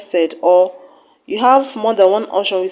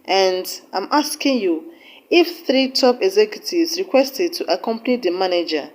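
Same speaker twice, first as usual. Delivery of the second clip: ordinary talk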